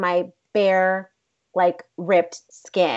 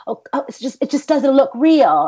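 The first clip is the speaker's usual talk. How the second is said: high-pitched mock British complaint; bad British accent; 7 semitones higher, 5 dB louder than usual. Director rejecting the take, in bad British accent. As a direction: imitate him in a hammed-up British accent. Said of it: "doing a very horrible job at a British accent".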